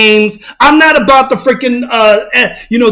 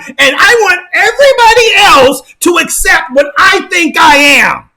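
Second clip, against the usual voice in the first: mocking inner voice; 6 semitones higher than usual. Vlogger voicing the mind of someone playing make-believe, high mocking shout. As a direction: shout it like a pleading self-deceiver. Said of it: "your mind is telling you".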